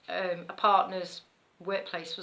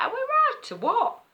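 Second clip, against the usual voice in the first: high-pitched, louder mock squeal; retold alarm; 5 dB louder than usual. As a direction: shoot the voice right up in mock disbelief. Retells reaction to bees swarming.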